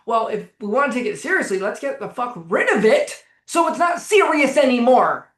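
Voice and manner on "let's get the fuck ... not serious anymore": louder, higher, emphatic outburst; exasperated near-yell. Real, not acted. Coach railing against taking anxiety too seriously, exasperated near-yell. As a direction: raise your voice at a crazy idea. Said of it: "I'm not yelling at you".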